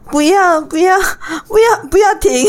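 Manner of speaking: high, whimpering "不要" with catches; mock sobbing plea. Shown as a demonstration. Co-host tries the crying-voice "不要" demonstration. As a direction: playfully beg in a faked cry. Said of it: "这个我会".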